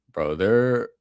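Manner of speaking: ordinary talk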